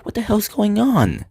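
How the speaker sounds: venting at the radio, worked up; exasperated. Real, not acted. Ranting at the weather service.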